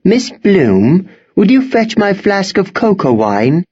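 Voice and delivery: high, proper headmistress request; headmistress, resigned. A narrator's reading.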